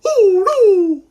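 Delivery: very high, loud mock-frightened wail; scared little brother. Showing kids how little brother gets scared, high frightened wail. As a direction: wail it like a terrified little kid. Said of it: "Can you guys get scared with me?".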